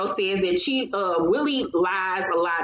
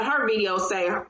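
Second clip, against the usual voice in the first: normal talking voice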